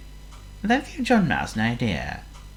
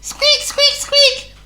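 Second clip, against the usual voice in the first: series of very high, loud squeaks; mouse squeaks; 5 dB louder, much slower than usual. A narrator's reading. John Mouse by the bed waking his owner, insistent squeaks. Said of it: "he squeaked".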